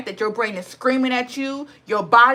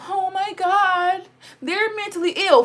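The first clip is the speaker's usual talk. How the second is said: mocking voice; 7 semitones higher than usual. Vlogger mocking people who pity the mentally ill, high mock whine. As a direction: parody the pitying crowd, pitched up. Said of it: "everybody walks around being so".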